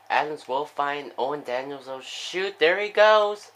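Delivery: rising from brisk call to high, loud yell; building excited call. Real, not acted. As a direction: build to an alarmed shout as the runner breaks free. Runner breaks away in play-by-play.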